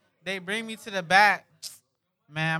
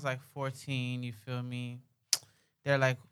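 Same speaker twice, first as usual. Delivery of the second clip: ordinary delivery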